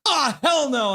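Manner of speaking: high, loud indignant cry easing slightly; acted player outburst. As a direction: erupt in mock outrage for the player. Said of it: "D P D's like".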